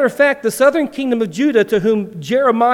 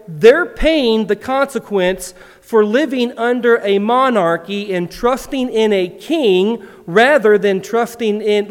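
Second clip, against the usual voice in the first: usual speaking voice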